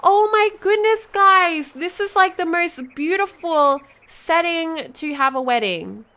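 real breathless-with-delight excitement; too excited. Real, not acted. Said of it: "too excited now. I'm sorry if I'm yelling".

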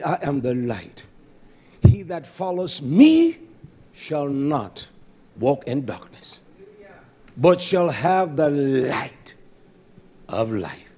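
slow, measured recitation; solemn scripture reading. Real, not acted. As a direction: let each word land.

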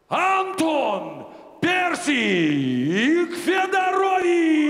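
long stretched name call; announcer shout. Acted. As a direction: draw out the fighter's name. Announcer calls fighter's name.

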